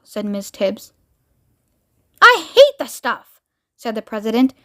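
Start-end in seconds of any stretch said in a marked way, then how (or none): 2.2-3.4 put-on high, drawn-out childish whine; petulant protest. President refusing Miss Tibbs's warm milk, petulant whine. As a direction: protest slowly and loudly like a sulky child.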